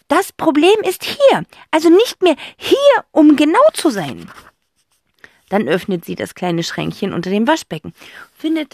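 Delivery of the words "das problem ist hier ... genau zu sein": a fussy aunt explaining to the fox; dotty old aunt. Acted. Aunt Rotz tells the fox the problem is gone.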